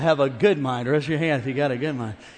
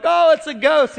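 higher, louder panicked yelp; mock scared cry; 10 semitones higher, 10 dB louder than usual. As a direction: cry out at a ghost in fright. Frightened disciples in storm.

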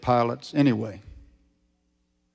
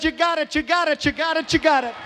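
high-pitched repeated holler; wife's shouting. Acted. Wife shouting at the back door, high holler. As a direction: chant it out excitedly at a very high pitch. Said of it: "His wife was shouting at the back door".

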